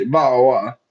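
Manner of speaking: British accent, glottal stops in "Bottle" and "water"; mock British accent. Shown as a demonstration. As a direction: show off your British, dropping the T's. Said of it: "I can say it in British".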